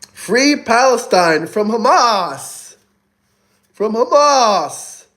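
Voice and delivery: high, slow, deliberate delivery; slow emphatic slogan. Acted. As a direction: drag the punchline out for effect. Punchline slogan to chat.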